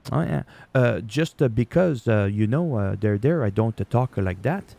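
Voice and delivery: hammed-up French accent with filler "uh"s; mock French accent. French speaker mocking how people assume he talks, French accent. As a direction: stumble along in a caricatured French accent. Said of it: "make fun of that accent".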